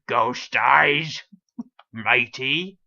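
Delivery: loud, hammed-up pirate voice; mock the viewers as a pirate, punched out; middle-aged pirate. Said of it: "talk like a, uh, middle-aged pirate".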